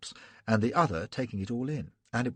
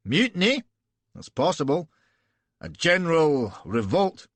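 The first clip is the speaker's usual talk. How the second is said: loud, raised Scottish burr; 5 semitones higher, 5 dB louder than usual. A narrator's reading. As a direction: arrogant Scots officer. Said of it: "arrogant Scotch burr".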